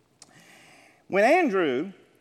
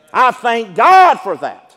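louder, slow declaration of thanks; loud slow fervent praise; 11 dB louder, slower than usual. Real, not acted. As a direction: proclaim it with full force, slowly.

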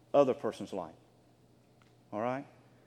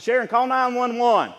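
calling to wife; 8 semitones higher, 9 dB louder than usual. Retold or imitated. Calling back to his wife.

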